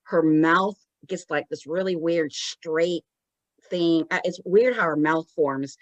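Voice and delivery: usual speaking voice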